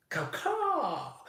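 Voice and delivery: high-pitched screeched caw-caw; imitated bird call; mock bird caw. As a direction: let out a high bird cry. Imitates eagle cry in boast.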